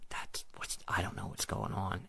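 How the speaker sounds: a breathless whisper, barely voiced; stunned whisper. Stunned after the healed worker rushes off, a hushed whisper.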